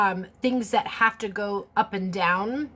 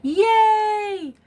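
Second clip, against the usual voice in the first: excited cheer; 11 semitones higher, 5 dB louder than usual. Real, not acted. Cheering as stream starts.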